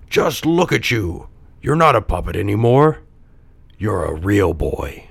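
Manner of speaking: put-on old man's awed voice, slowing down; father in wonder. A narrator's reading. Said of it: "gazed in wonder".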